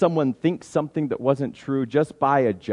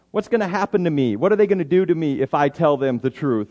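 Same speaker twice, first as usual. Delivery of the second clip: normal talking voice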